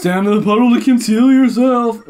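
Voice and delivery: loud, high game-announcer voice; announcer read. Acted. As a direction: boom it like a trailer voice. Reads game text aloud.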